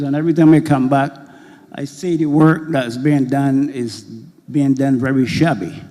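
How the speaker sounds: ordinary delivery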